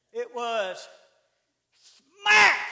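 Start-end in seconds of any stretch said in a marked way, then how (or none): 2.2-2.6 loud, higher smack; sharp, loud onomatopoeic smack; slap sound word. Retold or imitated. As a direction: snap it out like a slap.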